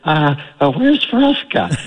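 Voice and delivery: high thin squeaky voice; thin reedy voice. Retold or imitated. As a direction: pipe up in a small reedy voice. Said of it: "say in this reedy voice".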